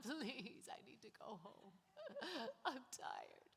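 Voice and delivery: mock tearful pleading, half laughing; crocodile tears. Begging the tire shop guy for a slot, hammed-up fake crying. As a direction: fake a weepy plea to the clerk. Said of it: "put on the crocodile tears".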